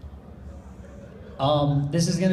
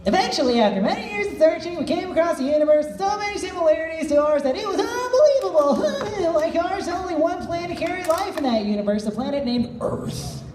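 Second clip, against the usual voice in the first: high, lively Mew voice held through the speech; 7 semitones higher than usual. Acted. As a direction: keep Mew's pitch up as the story goes on. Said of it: "Is this still Mew".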